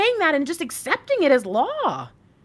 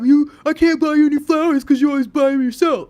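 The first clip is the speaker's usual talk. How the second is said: imitating Mister Lightwork; 2 semitones higher, 10 dB louder than usual. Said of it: "Mister Lightwork would be like".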